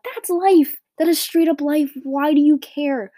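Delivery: loud, high exasperated outburst; exasperated retort. Retold or imitated. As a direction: throw it back at them, loud and incredulous. Acting out exasperated reply to friends.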